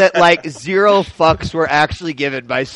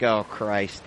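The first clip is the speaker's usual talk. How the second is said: muttered groan; 8 semitones lower, 10 dB quieter than usual. Retold or imitated. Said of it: "And I'm like".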